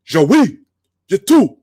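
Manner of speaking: high, loud Klingon bark; yelled Klingon command. Retold or imitated. Torg yells an order to his crew, yelling. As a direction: shout the alien order across the bridge. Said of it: "Torg yells out".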